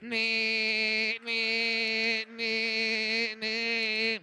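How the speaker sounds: long monotone electric wheelchair whirr; motor whine imitation. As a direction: drone it like a little motor, one note. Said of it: "one of those automatic wheelchairs".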